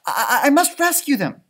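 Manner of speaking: high, urgent stammering voice. Acted. Stella Luna rushes to rescue her falling friends. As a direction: hurry to save them.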